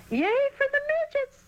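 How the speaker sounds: put-on high, squeaky cheer from girls. A narrator's reading. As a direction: cheer it out, high and shrill-sounding. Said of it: "they yelled".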